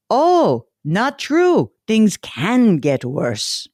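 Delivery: a cheerful mock Bulgarian optimist in a put-on accent; lightly voiced Bulgarian accent, playful. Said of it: "I'll do it with his little accent".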